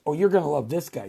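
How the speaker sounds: ordinary talk, nothing marked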